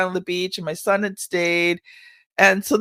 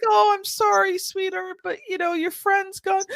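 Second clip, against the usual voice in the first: soothing grandma; 10 semitones higher than usual. Retold or imitated. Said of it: "I'm like".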